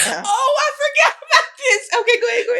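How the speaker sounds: high, giggling through the words; bursting into laughter as she remembers; laughing. Real, not acted. Co-host remembering the Walmart story, laughing.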